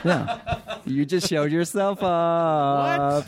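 high, laughing teasing; amused ribbing with a laugh. Real, not acted. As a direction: tease through laughter. Laughing at the guest's slip.

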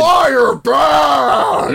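loud, high monster shout; Frankenstein monster. Retold or imitated. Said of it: "he's like".

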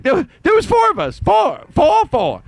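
high shouted repeat of four; mock shout. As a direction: yell four, climbing up. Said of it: "at the end he's like".

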